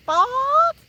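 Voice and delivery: high, loud cawing crow voice; crow imitation. Telling chat what Australian crows sound like, loud high caw. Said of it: "Even the crows said".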